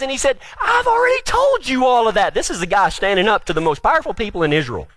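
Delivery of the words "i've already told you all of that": exasperated defiance. Acted. Healed man standing up to powerful questioners, exasperated defiance. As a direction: stretch it out high, fed up with questioners.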